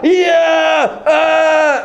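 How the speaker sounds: high, screamed cheer; wild acted cheer. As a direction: yell like a crowd erupting. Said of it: "And everyone's cheering".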